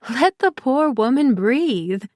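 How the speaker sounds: play-scold the pushy friend; mock disgust. Penny mock-scolds Pri's pushiness, a light chiding tone. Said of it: "shook her head in mock disgust".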